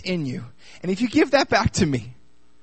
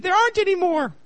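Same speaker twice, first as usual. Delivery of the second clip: lightly voiced young boys, pitched higher; young sons' reply; 12 semitones higher than usual. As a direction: answer like a small child. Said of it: "her sons said".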